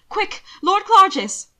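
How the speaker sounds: high, loud, rushed call; urgent call. A narrator's reading. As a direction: call out quickly to hurry someone. Calls out hurriedly across the ball.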